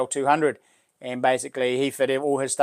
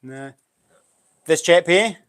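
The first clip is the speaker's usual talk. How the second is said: ordinary talk, nothing marked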